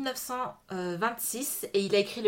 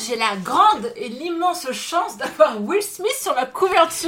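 loud, slow, higher, mock-grand boast; mock pride; 5 semitones higher, 8 dB louder, slower than usual. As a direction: brag as if it were a treasure. Mock-proud [name] cover.

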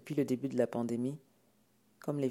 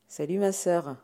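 loud, higher-pitched greeting; would-be casual exclamation; 5 semitones higher, 8 dB louder than usual. Acted. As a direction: call out, trying to sound offhand. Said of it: "s'exclama-t-il". (exclamation faussement désinvolte)

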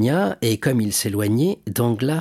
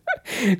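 hearty chuckle; 10 semitones higher than usual. Acted. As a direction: chuckle with delight. Delighted shipowner chuckles. (petit rire joyeux)

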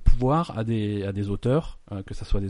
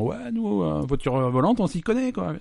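mocking brand voice; 8 semitones higher, slower than usual. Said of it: "en disant".

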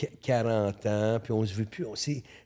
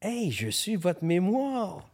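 pompous; 6 semitones higher, 4 dB quieter, much slower than usual. Retold or imitated. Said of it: "Quelle prétention".

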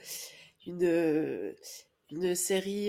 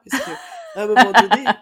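laughing; 3 semitones higher, 17 dB louder than usual. Real, not acted. Retelling brother's joke, laughing.

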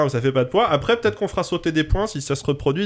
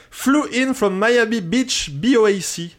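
French-accented English; 5 semitones higher than usual. Shown as a demonstration. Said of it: "Avec mon accent anglais absolument impeccable". (anglais avec accent français, lent et posé)

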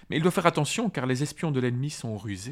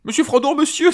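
much higher, louder frightened cry; Sam crying out in fear; 13 semitones higher, 9 dB louder than usual. A narrator's reading. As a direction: cry out for help, panicked. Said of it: "s'écria Sam tremblant". (Sam terrifié, crie à l'aide)

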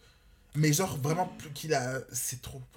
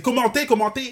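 excited; 9 semitones higher, 10 dB louder than usual. Real, not acted. Hyped outro call to comment.

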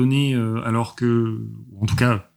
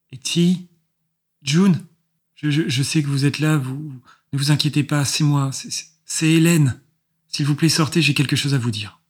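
put-on high, thin, hesitant girl's voice; thin little voice; 4 semitones higher than usual. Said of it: "une petite voix fluette".

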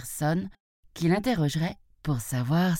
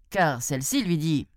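usual speaking voice

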